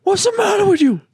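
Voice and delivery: high and loud hammed-up worried voice; shrill mock concern over a fake sob; mock fretting. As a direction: fuss over them like a panicked parent, playing it up. Mock fretting over the wailing sibling.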